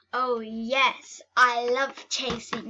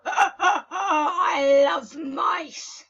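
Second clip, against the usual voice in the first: loud, slow evil laugh into gloating; cackle, then relish it like a villain; 5 semitones higher, 5 dB louder, much slower than usual. Pretend. Cat villain gloats over mice.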